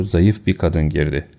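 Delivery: usual speaking voice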